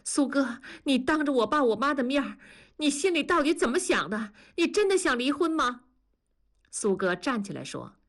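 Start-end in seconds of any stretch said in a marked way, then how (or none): 0.0-5.9 higher-pitched, distressed confrontation; trembling. A narrator's reading. Wife challenging husband before her parents, trembling and distressed. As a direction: rise higher with each question, upset and trembling. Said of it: "南方哆嗦着说".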